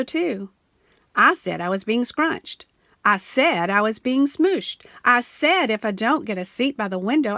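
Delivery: plain reading voice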